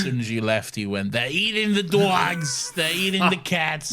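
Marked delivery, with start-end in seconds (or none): 1.1-3.9 slow, drawn-out politician impression, pitched up; hammed-up presidential impersonation; Donald Trump. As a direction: stretch each phrase in a mock campaign-speech voice. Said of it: "Donald Trump".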